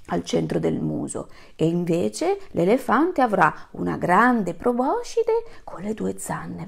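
ordinary delivery

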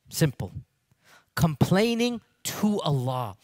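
normal talking voice

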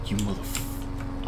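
soft slow whispered oath; real whisper; whispered curse. Real, not acted. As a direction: hiss the curse under breath.

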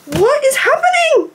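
high squeal of disbelief; reliving her shock. Retold or imitated. Fan recalling getting in mum's car, squealing. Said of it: "I'm just like".

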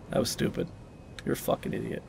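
very soft, under the breath; muttered self-reproach. Real, not acted. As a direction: mutter to yourself, barely voiced.

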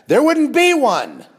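a real ranting outburst, high and loud. Real, not acted. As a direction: blurt it out, carried away. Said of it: "Oh, I'm sorry".

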